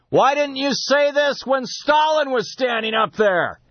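high, drawn-out call from the back; imitated heckler's yell. Official heckling from the back of the Politburo, imitated yell. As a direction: call it out slowly and high across a big room. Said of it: "somebody in the back yells".

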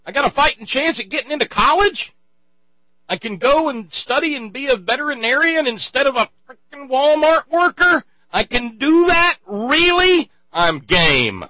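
high, loud, disbelieving joy; struggling worker yelling with delighted disbelief; overjoyed struggling worker. Retold or imitated. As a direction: shout it like a kid who just won the lottery. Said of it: "the struggling classes ... are asking".

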